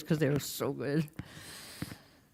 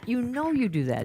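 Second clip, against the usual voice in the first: ordinary delivery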